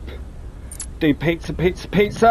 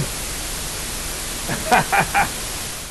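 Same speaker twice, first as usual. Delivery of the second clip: short burst of laughter after a long gap; laughing; 4 semitones higher, 6 dB quieter than usual. Real, not acted. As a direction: break into a quick cackle at the mistake. Bursts out after getting order wrong.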